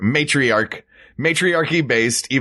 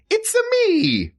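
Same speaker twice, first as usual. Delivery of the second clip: very high cartoon Italian accent; mock Nintendo Italian. Host teases co-host's Italian impression, mock high Italian accent. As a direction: squeal it like a video-game Italian. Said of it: "every Italian begins their sentences with".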